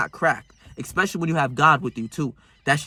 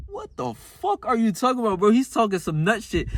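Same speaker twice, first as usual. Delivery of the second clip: higher, mock-exasperated rebuke; scolding himself; 7 semitones higher than usual. Talking to himself as if someone else were there. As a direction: brush the doubt off, pitched up. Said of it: "I'm literally talking to myself".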